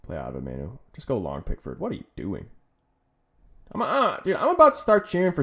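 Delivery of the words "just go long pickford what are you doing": ordinary delivery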